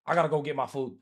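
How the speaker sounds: ordinary delivery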